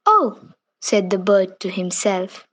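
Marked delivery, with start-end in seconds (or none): 0.1-0.4 a much higher, louder oh of surprise; surprised oh. A narrator's reading. Bird reacts to the prince's sad story, surprised. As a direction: let the oh jump up.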